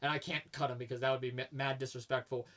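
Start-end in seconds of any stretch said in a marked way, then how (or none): none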